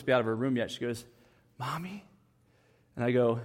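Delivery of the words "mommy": put-on a soft, hushed little-girl Mommy; whispered Mommy. Daughter tiptoeing into her parent's room, hushed whisper. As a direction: imitate a child sneaking a tiny call, hushed. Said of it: "she whispers".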